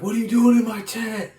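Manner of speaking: high, loud angry demand; furious shout; high loud yell at an intruder; pissed. Retold or imitated. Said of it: "like, pissed".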